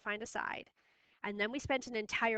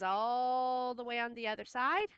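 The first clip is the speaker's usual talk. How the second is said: "all" held long and level. Shown as a demonstration. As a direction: stretch the word "all" to show the distance. Teacher stretches "all" to show distance.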